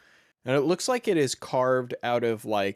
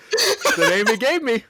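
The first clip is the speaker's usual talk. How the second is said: laughing; 12 semitones higher, 10 dB louder than usual. Real, not acted.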